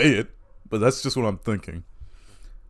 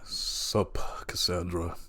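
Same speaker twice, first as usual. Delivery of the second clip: much softer, breathy too-cool greeting; Rudy's cool voice; 7 semitones lower, 12 dB quieter, much slower than usual. Retold or imitated. Rudy greeting the girl he slapped, soft and cool. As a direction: play it totally unbothered. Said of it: "Rudy would just be like".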